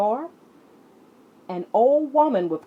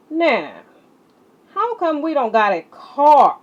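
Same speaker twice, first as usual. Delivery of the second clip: put-on higher, louder kid's question; a small boy pushing his point; curious child; 7 semitones higher, 9 dB louder than usual. CJ asking Nana why they have no car, insistent.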